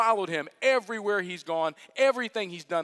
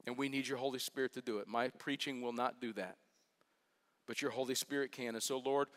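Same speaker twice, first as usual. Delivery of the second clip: prayer; 7 semitones lower, 7 dB quieter than usual. Acted. Pastor prays aloud before the sermon, hushed and earnest. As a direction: pray quietly and earnestly for the room.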